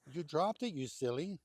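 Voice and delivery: usual speaking voice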